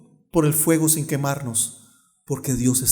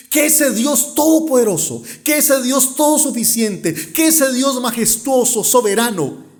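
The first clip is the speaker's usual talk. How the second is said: higher, emphatic preacher's proclamation; fervent preaching; 9 semitones higher, 4 dB louder than usual. Real, not acted. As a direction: proclaim each title of God with force. Preaches with mounting fervour. (predicación ferviente)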